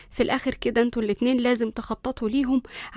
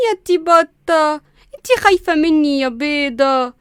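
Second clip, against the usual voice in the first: mock baby talk; 4 semitones higher, 8 dB louder than usual. Nanny teasing the frightened boy.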